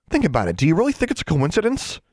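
high, insistent challenge; urgent persuasion. A narrator's reading. Girl convincing her friend of a plot, insistent. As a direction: press the point, climbing high.

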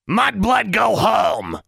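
a spiteful pet shrieking from its cage; nasty creature shrieking an insult. Acted. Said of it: "shrieked Honey".

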